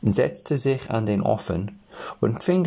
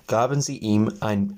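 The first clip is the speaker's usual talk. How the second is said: plain reading voice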